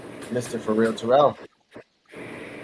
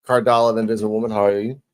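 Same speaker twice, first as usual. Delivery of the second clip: ordinary delivery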